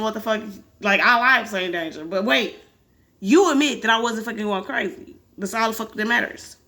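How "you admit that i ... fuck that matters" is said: plain reading voice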